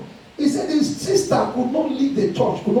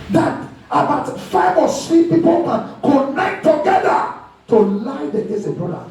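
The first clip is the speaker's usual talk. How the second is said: forceful preaching; 5 semitones higher than usual. Real, not acted. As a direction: preach it out in indignation, stretching the words.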